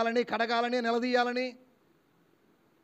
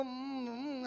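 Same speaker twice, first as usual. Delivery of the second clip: muffled closed-mouth "mm, mm"; mute wife's humming; 5 semitones higher, 9 dB quieter, much slower than usual. Acted. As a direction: imitate a mute wife grumbling wordlessly, softly. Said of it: "భార్య మూగదైనా కానీ ఆమె ఏదో".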